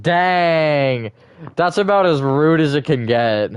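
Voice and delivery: loud, high shocked exclamation; playfully scandalised; mock outrage. Real, not acted. Streamer reacts to a rude insult from chat, mock shocked.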